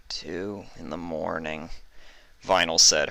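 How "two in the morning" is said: much softer and slow, seething; quiet, dangerous calm; flat disbelief. A narrator's reading. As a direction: hold back rising irritation. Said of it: "her eye twitching".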